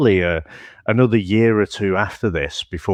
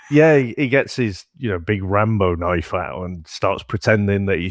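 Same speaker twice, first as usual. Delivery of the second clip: ordinary talk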